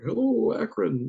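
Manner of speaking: high, drawn-out mock rock-star greeting; goofy stage hello. Fooling at an unattended mic, goofy high stage hello. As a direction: call out a silly, stretched "Hello", falling off at the end. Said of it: "say something stupid like".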